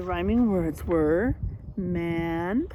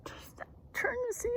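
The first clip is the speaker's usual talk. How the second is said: whisper; 11 dB quieter than usual. Real, not acted. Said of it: "class instruction".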